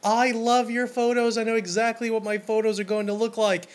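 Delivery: thrilled bride. Retold or imitated. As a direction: sound like a bride won over. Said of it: "they're like".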